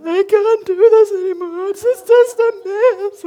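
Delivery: high, loud sobbing meltdown; bursting into tears in front of the former head, loud and high; crying. Retold or imitated. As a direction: wail it through tears. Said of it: "I just burst into tears".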